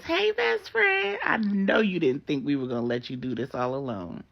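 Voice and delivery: high, loud joyful hello turning teary; excited greeting. Acted. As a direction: squeal it with love. Regina surprises her friend.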